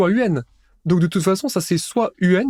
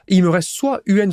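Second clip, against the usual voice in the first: ordinary delivery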